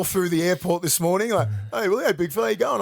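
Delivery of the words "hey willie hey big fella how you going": smug fan greeting. Retold or imitated. Fans in the airport.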